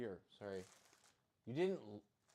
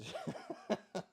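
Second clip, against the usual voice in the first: high squeaky laugh; high giggle. Real, not acted. Laughing about fake Italians, high giggle.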